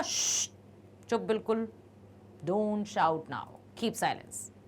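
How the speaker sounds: very slow, soft hush for silence; calm the room down quietly; hushing a class. Retold or imitated. Teacher hushing a noisy class, slow and soft. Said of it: "जैसे हम करते हैं ना".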